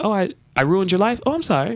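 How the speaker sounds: high-pitched imitation of careless sorry; mock apology. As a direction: mimic someone apologizing without meaning it. Mocks colleague's careless apology.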